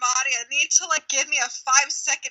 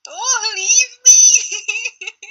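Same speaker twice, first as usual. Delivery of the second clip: very slow, louder, stretched through laughter; laughing plea; 6 semitones higher, 5 dB louder, much slower than usual. Real, not acted. Begging Meg not to leave, drawn out with laughter. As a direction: plead dramatically while laughing. Pleading with departed friend.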